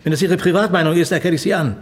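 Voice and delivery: stern rebuke. Acted. Superior ends a political discussion with his subordinate, stern rebuke. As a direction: put the subordinate firmly in his place.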